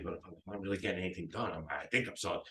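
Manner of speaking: low, soft private doubt; talking to oneself. Acted. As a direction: voice a seller's quiet inner admission. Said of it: "they're saying this to themselves".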